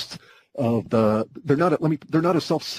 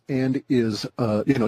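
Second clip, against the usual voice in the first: normal talking voice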